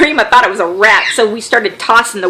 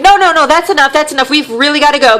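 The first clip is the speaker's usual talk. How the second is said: higher, urgent no no no; the family hastily declining another drink, higher pitched; hurried refusal; 6 semitones higher than usual. Retold or imitated. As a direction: everyone rushing to refuse politely. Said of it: "everybody's like".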